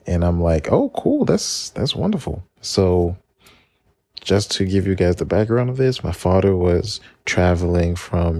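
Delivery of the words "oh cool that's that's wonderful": put-on high, excited little-kid voice; bright child's reply, pitched way up; kid self answering. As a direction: play your six-year-old self, thrilled.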